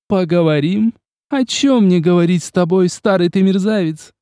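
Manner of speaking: mock the old scoundrel; angry mockery. A narrator's reading. The countess taunting Vladislav, angry and mocking. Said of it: "Сердито и насмешливо поинтересовался голос".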